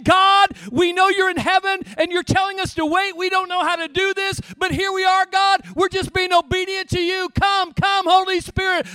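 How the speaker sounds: loud, high, pleading shout; fervent crying out. Retold or imitated. The disciples in the upper room, shouting their plea. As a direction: cry out to God with full force. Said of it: "They were saying".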